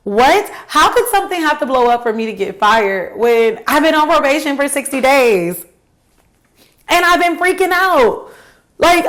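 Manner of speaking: high, loud exasperated disbelief; incredulous. Real, not acted. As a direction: rant it like you can't believe this. Incredulous reaction to boss.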